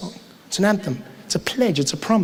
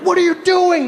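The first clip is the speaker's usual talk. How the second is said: mock outrage; 10 semitones higher than usual. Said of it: "They're like".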